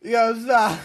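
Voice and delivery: high, loud, laughing plea; laughing protest. Real, not acted. Friend cracking up at the back joke, laughing and loud. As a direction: beg him to stop while cracking up.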